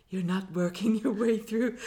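tentative go at a character's accent; Stephen. Shown as a demonstration. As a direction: hesitantly try on someone else's voice. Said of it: "say it in Stephen's voice".

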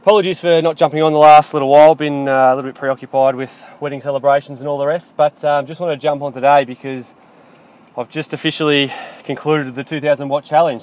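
breathless after a hard ride; out of breath, talking between breaths. Real, not acted. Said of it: "if I sound out of breath, it's 'cause I, I am".

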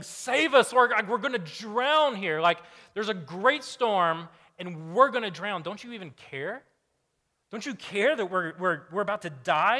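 loud, high frantic pleading over the storm; desperate shouting at a sleeping Jesus; panicked disciples. Retold or imitated. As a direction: cry out like they are about to drown.

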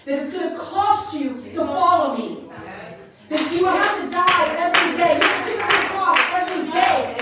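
loud, driving sermon rebuke; loud, relentless preaching; full-force preaching. Acted. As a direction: hammer the congregation with the rebuke.

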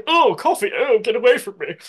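high, loud, put-on posh lady; mock a posh lady recoiling from coffee. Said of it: "in that posh voice".

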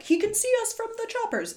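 mock movie-hero alarm, high-pitched; retold high-pitched alert; urgent realization. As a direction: imitate an urgent warning in a high voice. Retelling Keanu's realization in film.

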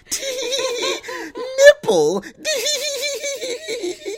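very high squealed giggle; high, tittering five-year-old girl impression, giggling through the word; giggly little girl. Friend imitating Steven giggling at the shots, giggly little girl. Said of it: "starts to giggle like a five-year-old little girl".

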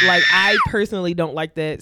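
loud, laughing protest. Real, not acted. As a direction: laugh it off loudly. Laughing protest after teasing.

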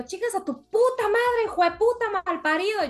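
put-on a kid yelling back at catcallers; yelling insults. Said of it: "yo así como gritándole". (grito de niña insultando, agudo y fuerte)

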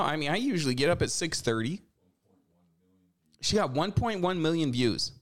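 ordinary talk, nothing marked

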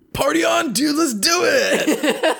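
high, laughing surfer-bro voice; party dude. Pretend.

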